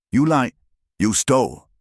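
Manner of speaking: high, sharp accusation; snapped accusation. A narrator's reading. Zahira accuses her sister before the court, snapping. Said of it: "Zahira snapped".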